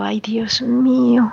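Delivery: whispered in dismay; shocked whisper. Retold or imitated. Recalling meeting Melisa, a horrified whisper. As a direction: breathe it out, aghast.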